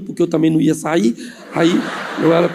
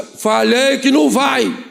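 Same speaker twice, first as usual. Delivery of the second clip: raised-pitch, drawn-out mock-angry refusal; fierce uncle; 6 semitones higher, slower than usual. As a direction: lay down the law as a cross uncle.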